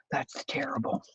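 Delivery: embarrassed whisper. Real, not acted. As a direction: whisper it, a bit mortified. Embarrassed about gulp.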